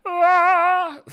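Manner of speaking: high shriek into laughter; startled shriek then laugh. Real, not acted. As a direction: yelp then crack up laughing. Startled by freeze frame.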